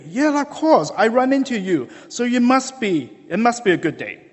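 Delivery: high, lively imitation of Ali; upbeat Ali. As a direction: sell it with a grin. Ali explains his luck.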